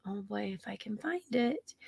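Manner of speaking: whispering to herself while searching; whispered aside. Real, not acted. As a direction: breathe it out under the breath.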